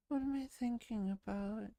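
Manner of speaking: normal talking voice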